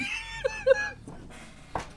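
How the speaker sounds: high, squealing wheezy laugh; hysterical laughter. Real, not acted. Player losing it over the irritating game, hysterical laughter. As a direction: laugh until the breath runs out.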